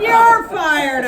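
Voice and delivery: high, loud roar back; roaring return; thundered back. Acted. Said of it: "I thundered back".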